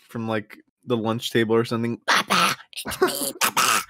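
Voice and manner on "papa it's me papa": put-on creepy kid voice. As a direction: coo it like a creepy toddler crawling closer. Voicing kid crawling to her.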